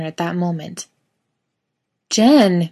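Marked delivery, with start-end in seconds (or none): 2.1-2.7 shouting a friend's name, higher and louder; calling out. A narrator's reading. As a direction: yell across to find him. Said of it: "Misha yells".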